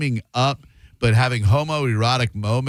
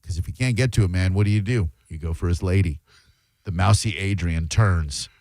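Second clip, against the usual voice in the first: normal talking voice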